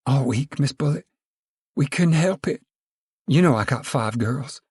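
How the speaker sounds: lightly voiced, anxious, high woman; nervous high whine; high timid voice. Flustered woman pleads.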